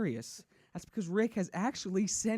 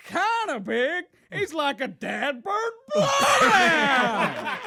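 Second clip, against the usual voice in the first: hammed-up yokel voice; 9 semitones higher, 8 dB louder, much slower than usual. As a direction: stretch it out like a hillbilly laughing. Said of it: "Greg then says".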